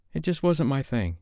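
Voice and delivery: Sophie, deflecting. Acted. Sophie deflects, chin raised.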